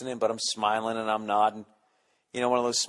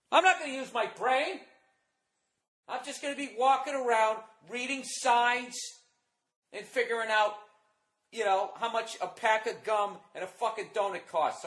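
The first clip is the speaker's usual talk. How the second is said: high, put-on dumb teenager voice, sliding softer; mock slacker kid; 10 semitones higher, 4 dB quieter than usual. Ranting about skipping school, mock slacker teen voice. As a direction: lampoon the kid who skips school in a higher voice. Mocking his lazy teenage self.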